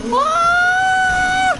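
very high scream; terrified scream. Real, not acted. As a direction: scream in fright. Monster attacks.

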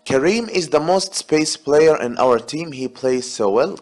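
reading the sentence out slowly and carefully; slow exam read. Shown as a demonstration. Teacher to students, reading the exam sentence slowly. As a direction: read it like a test item for the class.

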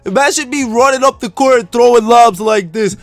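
excited showing off. Acted. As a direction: call out to Mom and coach, boasting. Showing off to mom and coach.

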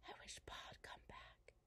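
hushed, fully whispered secret. A narrator's reading. Mary to Laura under the quilt, whispering. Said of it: "whispered to Laura".

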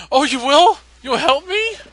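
very high, eager little creature voice; excited Pikachu. Acted. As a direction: squeak it out high with hopeful delight. Acting Pikachu's grateful reply.

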